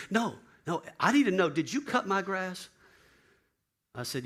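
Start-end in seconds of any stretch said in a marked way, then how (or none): 0.1-2.7 higher, louder, pressing question; insistent demand. Retold or imitated. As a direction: insist on knowing the answer. Bill insisting urgently.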